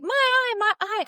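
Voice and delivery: hammed-up high-pitched yelp; mock panicked wail. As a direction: imitate a patient wailing over an injury. Retelling patient crying out again.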